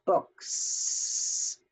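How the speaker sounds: "books" said very slowly with a drawn-out hissed S at the end; voiceless S ending. Shown as a demonstration. Teacher to students, modeling the voiceless S ending slowly. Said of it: "a voiceless S".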